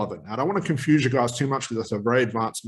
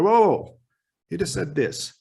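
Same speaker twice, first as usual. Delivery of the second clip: interrupting; 5 semitones higher than usual. Shown as a demonstration. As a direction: stop the client mid-flow.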